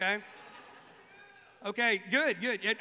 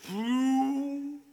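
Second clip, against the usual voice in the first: louder, higher mock spooky boo; playful boo; 5 semitones higher, 8 dB louder than usual. Jesus appearing in a locked room, louder mock boo. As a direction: jump out and spook them. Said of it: "if you appear in a room".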